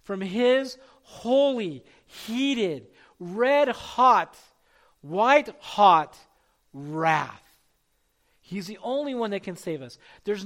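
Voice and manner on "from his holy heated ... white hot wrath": slow, growing louder word by word up to wrath; building preaching. Acted. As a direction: pile the words up, each one hotter. Escalating description of God's wrath.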